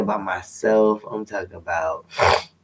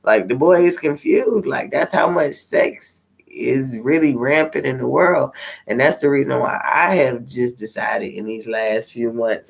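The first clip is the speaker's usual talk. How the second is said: ordinary talk, nothing marked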